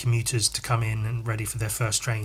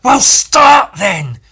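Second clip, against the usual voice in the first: very loud, higher, stretched-out retort; angry shout; 8 semitones higher, 12 dB louder, much slower than usual. Retold or imitated. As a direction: imitate a furious yell. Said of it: "how angry she was".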